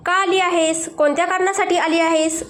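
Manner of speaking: plain reading voice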